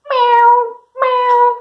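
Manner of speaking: very high little mews; cat meow. Shown as a demonstration. Teacher giving kids a clue for the cat, meowing. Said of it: "The cat says meow".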